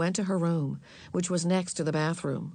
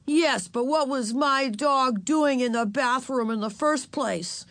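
put-on higher, indignant boy's question; demanding; 6 semitones higher, 3 dB louder than usual. A narrator's reading. Henry asking why his dog was there, demanding. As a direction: demand an answer, pitched up. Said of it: "Henry demanded".

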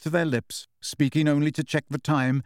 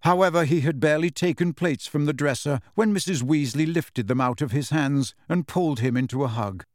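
plain reading voice

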